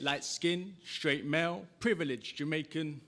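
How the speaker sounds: high, projected rhythmic recital; spoken-word performance. Acted. Poet leading a crowd's call and response, high declaiming. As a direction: declaim it in punchy lines, leaving room for responses.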